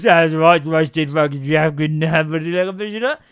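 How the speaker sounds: loud gibberish, words smeared together; unintelligible babble. Retold or imitated. Son tells dad about his day, gabbled nonsense. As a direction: parody a garbled report of the day. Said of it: "What he's saying was it was all right".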